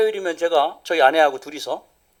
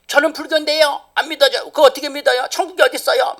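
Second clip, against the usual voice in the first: challenging schoolgirl; 11 semitones higher than usual. Retold or imitated. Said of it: "막 이러면서".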